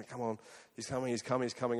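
muttered waiting. Retold or imitated. Waiting in cold for driver.

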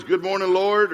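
glad, rising hail to the Lord; cheerful morning greeting. Acted. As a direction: greet the Lord eagerly, voice lifting on LORD. Two contrasting ways to greet morning.

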